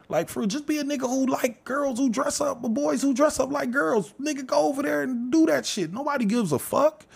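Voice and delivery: high incredulous rant; exasperated high pitch. Real, not acted. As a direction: voice jumps high, fed up and incredulous.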